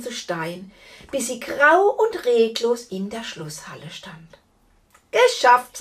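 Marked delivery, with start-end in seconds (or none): 5.1-5.8 loud, pleased exclamation; triumphant. A narrator's reading. Knight celebrating her win over the giantess, loud triumph. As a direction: announce victory at full voice.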